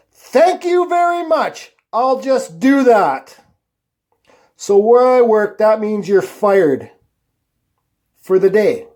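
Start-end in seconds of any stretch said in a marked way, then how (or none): 0.1-3.4 high, slow, drawn-out retort; retold comeback, stretched out and pitched way up; pointed mock thanks. Worker sent home by the foreman, pointedly thanking him.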